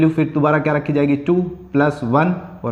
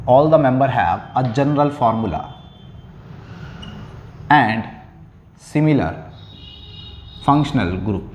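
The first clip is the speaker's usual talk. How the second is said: slow dictation; much slower than usual. Shown as a demonstration. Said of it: "teacher dictating definition".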